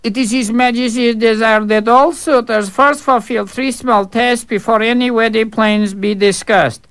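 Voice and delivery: high, formal announcement voice; pompous servant. Acted. Servant formally announcing the tests to the fool. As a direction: read out the decree with mock ceremony.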